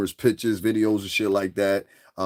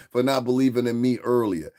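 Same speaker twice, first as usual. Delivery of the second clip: ordinary talk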